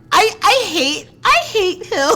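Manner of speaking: high, loud, cracking with laughter; flustered laughing whine. Real, not acted. Reader cracking up over the boy she hates. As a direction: whine it out through giggles.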